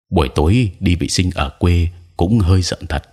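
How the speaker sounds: ordinary delivery, nothing marked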